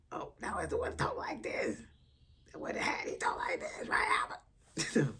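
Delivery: husky cartoon voice; hoarse cartoon-character impression; Fat Albert character. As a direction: put on a raspy cartoon kid's voice. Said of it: "character of Fat Albert".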